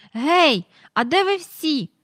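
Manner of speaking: loud, high call through the house. A narrator's reading. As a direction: shout for everyone from the hall. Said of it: "гукнув він". (гучно гукає родину)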